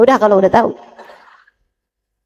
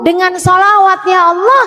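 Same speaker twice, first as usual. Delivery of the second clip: fervent prayer; 4 semitones higher than usual. Acted. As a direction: beg Allah earnestly, rising. Fervent supplication after sholawat.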